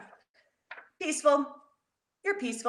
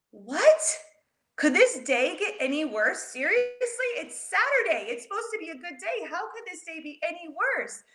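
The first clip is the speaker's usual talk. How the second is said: exasperated; 7 semitones higher than usual. Retold or imitated. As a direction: complain like the day is ruined. Child exasperated at bad day.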